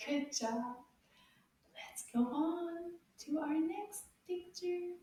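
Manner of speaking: ordinary delivery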